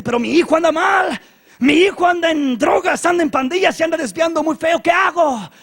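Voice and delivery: high, loud parental complaint; desperate parent. Acted. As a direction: cry out in exasperated desperation. Acted parent complaining about wayward son.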